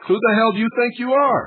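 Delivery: loud, high angry yell; furious shout. Real, not acted. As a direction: blast him with outrage. Host furious at the guest.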